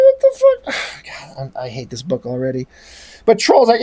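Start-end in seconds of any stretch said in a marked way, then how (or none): 0.0-0.8 very high, incredulous squeak; appalled squeal. Real, not acted. Reviewer appalled by the cab driver scene, high incredulous squeal. Appalled reaction to comic dialogue. 1.1-2.7 low, quiet, deflated sigh; weary disgust. Real, not acted. Reviewer already hating the comic, low weary disgust. Gives up on the book.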